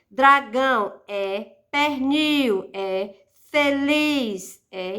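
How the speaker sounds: Dragão, pernil, feliz said slowly with the last syllable drawn out and leaned on; slow each word to show its final stressed syllable; stressed syllable shown. Shown as a demonstration. Teacher showing students the oxítona stress, slow and drawn out. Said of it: "Tem que ter uma palavra que não seja oxítona".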